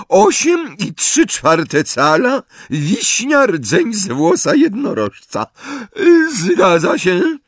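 high, loud, drawn-out official voice. A narrator's reading.

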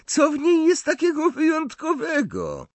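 mocking, very high-pitched question; scornful, squeaky incredulity; Malfoy. A narrator's reading. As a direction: sneer upward in disbelief. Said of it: "zadrwił Malfoy".

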